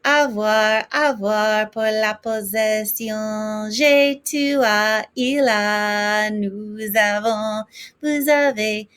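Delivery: avoir forms spelled out slowly and clearly, each pronoun and verb pronounced with care; French conjugation drill. Shown as a demonstration. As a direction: recite the avoir chart like a French teacher, crisp and deliberate. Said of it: "Step one ... is conjugating avoir".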